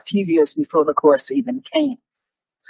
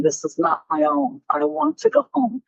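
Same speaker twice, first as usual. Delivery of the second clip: ordinary talk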